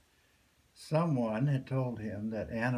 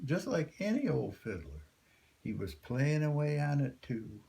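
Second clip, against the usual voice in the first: usual speaking voice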